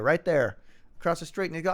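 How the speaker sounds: ordinary delivery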